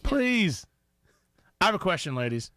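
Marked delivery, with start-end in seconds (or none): 0.0-0.7 higher-pitched, coaxing plea; pleading. Real, not acted. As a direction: beg him to give it a try. Begging again, more insistently.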